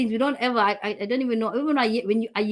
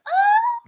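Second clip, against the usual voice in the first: 1 tickled squeal; 4 dB louder than usual. Retold or imitated. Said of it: "I'm like".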